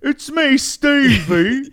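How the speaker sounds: high, slow hammed-up British; British Stevie voice. Friend pretending to answer Big Lou's phone, slow British accent. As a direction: stretch it in exaggerated British. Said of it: "his friend Stevie".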